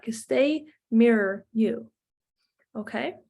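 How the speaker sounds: usual speaking voice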